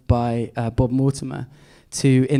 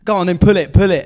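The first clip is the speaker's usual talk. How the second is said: loud mock taunt, pull it; egging him on loudly, half in jest; imitating a shout; 3 semitones higher, 8 dB louder, faster than usual. Imitating a magician's loud dare to pull it. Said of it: "and he goes".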